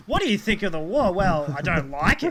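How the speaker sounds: hammed-up Q and A; mock interviewer. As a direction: play both sides of a mock interview. Mock interview of Russian lady.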